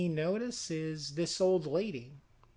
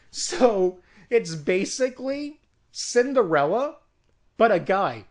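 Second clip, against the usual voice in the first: louder, a punchy excited payoff; excited reveal; 3 semitones higher, 9 dB louder than usual. Real, not acted. Pitching his movie idea to viewers, a loud excited reveal. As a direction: land the twist with a burst of energy.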